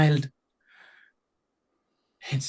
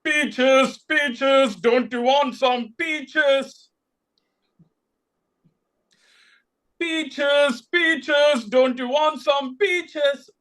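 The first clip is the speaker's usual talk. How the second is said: calling out; 5 semitones higher, much slower than usual. A narrator's reading. Lord calling out, louder.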